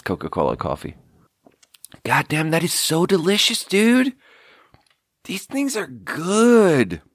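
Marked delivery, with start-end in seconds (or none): 2.0-7.0 delighted rave. Real, not acted. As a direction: savour it with sheer joy. Tasting vanilla coffee Coke.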